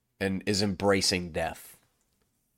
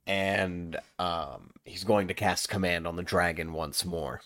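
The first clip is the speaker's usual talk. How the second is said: usual speaking voice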